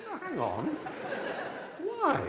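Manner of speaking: very high, loud laughing why; indignant disbelief. Retold or imitated. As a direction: squeak out the why in mock outrage. His indignant retold question.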